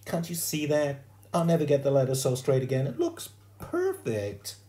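put-on high-pitched girl's protest, climbing at the end; pleading schoolgirl. A narrator's reading. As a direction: plead for your handiwork, stretching the last words. Sheila pleads for her cover.

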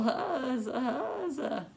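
put-on raspy, giggly old woman cheer; lightly voiced raspy grandma; raspy Gram voice. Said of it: "she said in her raspy voice".

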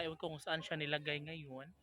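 ordinary delivery

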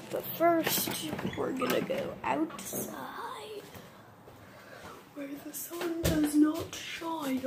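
mysterious whisper. Pretend. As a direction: hush it like a secret.

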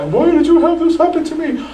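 high, bitter cry of why me; half-acted angry protest; mock complaint at God. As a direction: imitate someone railing at God. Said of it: "start cursing God and say".

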